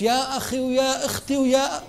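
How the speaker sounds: stretched-out imitation of a back-and-forth argument; mock haggling. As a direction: act out two people haggling, slowly and high. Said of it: "اثنين بنتناقش".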